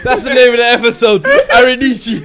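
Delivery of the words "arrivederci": high, loud arrivederci through laughter; laughing shout. Real, not acted. Naming the episode, laughing shout. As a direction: crack up while calling out arrivederci.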